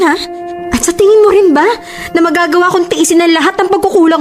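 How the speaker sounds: high-pitched, indignant pleading; exasperated outburst. Acted. Girlfriend insisting she is not cheating, exasperated. Pleading her loyalty in quarrel.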